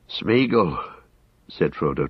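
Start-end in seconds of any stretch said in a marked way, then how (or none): 0.1-0.8 strained call, pitched up; trying to call. A narrator's reading. Frodo calling for the missing guide, strained. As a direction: call out into the dark with effort. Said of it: "trying to call".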